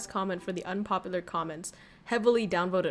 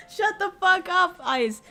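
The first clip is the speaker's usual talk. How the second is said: laughing shout; 9 semitones higher, 5 dB louder than usual. Real, not acted. Laughing outburst at a teammate.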